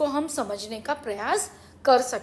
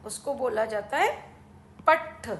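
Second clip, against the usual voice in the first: ordinary talk, nothing marked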